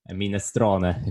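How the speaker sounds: normal talking voice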